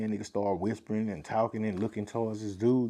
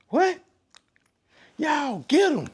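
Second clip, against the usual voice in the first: much higher and louder, shouting the order; furious command; 7 dB louder, slower than usual. Retold or imitated. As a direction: sic the whole crowd on him. Fly orders crowd to attack.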